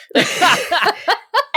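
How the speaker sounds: high burst of laughter. Real, not acted. Teller cracks up at her own punchline, laughing.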